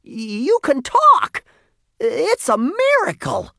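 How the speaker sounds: very high, loud, drawn-out amazement; boy shouting in disbelief; astonished cry. A narrator's reading. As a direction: cry each phrase out slowly in wonder. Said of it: "cried Jasper".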